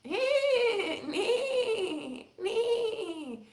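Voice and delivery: high cackling neigh; witchy horse neigh. Pretend. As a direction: squeal it out like a witch. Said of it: "Now I sound like a witch. I went".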